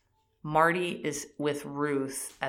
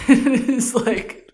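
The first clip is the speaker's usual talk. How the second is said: loud, high, laughing through the words; real laughing; 9 semitones higher, 10 dB louder, slower than usual. Real, not acted. Recapping his foolish call to Helen, laughing. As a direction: talk through a burst of laughter, slowing down.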